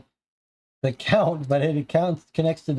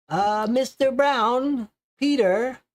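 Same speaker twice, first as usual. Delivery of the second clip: put-on higher, slow old landlady calling out; 8 semitones higher, slower than usual. Landlady calling her tenant through the door, higher old-woman voice.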